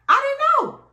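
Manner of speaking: high, loud burst of exasperation; exasperated outburst. Real, not acted. Telling the story of the rude dentist, a high, loud exasperated outburst. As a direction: throw it out, fed up and helpless.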